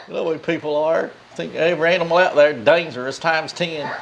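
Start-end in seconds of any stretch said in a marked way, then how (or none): none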